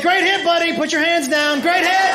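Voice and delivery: mock-enthusiastic dad call; cheering dad. Dad to buddy after a hit, cheering.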